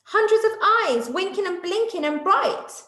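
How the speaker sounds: high, bright wonder; a young monkey gasping at the sights; amazed little monkey. Acted. Day Monkey amazed by night.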